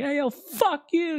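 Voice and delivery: high, slow mock insult; playfully yelling "fuck you" at listeners; mock rude heckle. Host ribbing his listeners, slow high mock insult. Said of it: "I'll be like".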